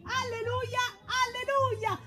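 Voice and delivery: preacher greeting. Acted. Preacher greeting the faithful with sing-song alleluias. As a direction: chant the greetings like a televangelist.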